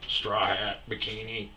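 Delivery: usual speaking voice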